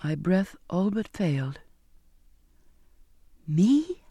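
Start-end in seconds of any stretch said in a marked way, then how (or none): 3.5-4.0 a breathless, barely voiced "Me?"; shocked whisper. A narrator's reading. Accused of murder, she gasps out a shocked whisper. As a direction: force the word out in shock. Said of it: "I managed to get out".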